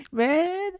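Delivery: high laugh into a sighed man. Real, not acted. Podcaster laughing at his own political rant. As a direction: cracks up at himself.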